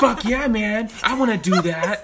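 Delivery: high, loud, pumped-up hype voice; hyped co-host voice. Retold or imitated. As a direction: do your friend all fired up and amazed. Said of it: "tell me if I sound like I gotta slay".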